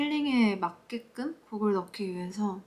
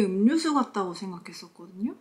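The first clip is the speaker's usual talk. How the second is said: muffled behind fist; speaking into curled hand; hand over mouth. Real, not acted. Seen 2: Fist pressed to lips from 3.2s; brief hand-over-mouth at 1.1s.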